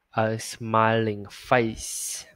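very slow, ring out the "ng" in "smiling"; slow drawn-out demo. Shown as a demonstration. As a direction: hold the ending sound of "smiling". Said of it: "ngân lên nè". (đọc thật chậm, ngân âm "ng")

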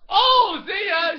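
loud, high teasing farewell; playful call-out. Pretend. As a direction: sing out a cheeky goodbye. Kid falls, dad calls out.

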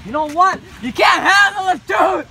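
full high dramatic shout; explosive shout. Acted. Officer blowing up at grieving brother, explosive shout. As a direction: bellow it at top volume, pitch soaring.

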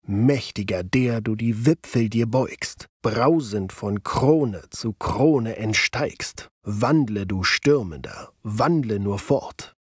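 slow, solemn dactylic rhythm; one stress, then two unstressed, very slowly. Shown as a demonstration. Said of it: "an Friedrich Rückerts Gedicht An den Sturmwind". (langsam und feierlich im Daktylus vorgetragen)

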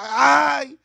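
mock crying out; imitated cry. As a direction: act out the cries of the suffering. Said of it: "donde la gente grita".

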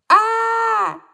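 put-on high loud wail of a hurt boy; child wail. A narrator's reading. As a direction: howl in pain. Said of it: "завопил Тимур". (громкий детский вопль)

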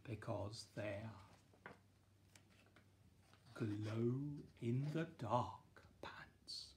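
slow secretive whisper. A narrator's reading. Secret reveal at the end.

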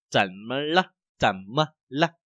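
怎么了 drawn out syllable by syllable; careful slow teaching demo of 怎么了; very slow reading. Shown as a demonstration. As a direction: say 怎么了 slowly, each syllable apart. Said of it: "mình đọc rất là chậm".

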